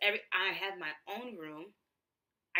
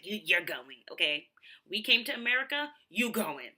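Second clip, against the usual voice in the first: louder, firm parent insisting; mock stern family; 8 dB louder than usual. Family insisting she return to school, in a lightly voiced, firm, louder tone. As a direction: lay down the law like an immigrant parent. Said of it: "They're like".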